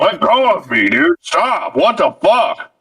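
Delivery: high-pitched acted protest; hammed-up high indignation; mock indignation. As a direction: object in rising, exasperated yelps. Acted Bowser struggling against Mario.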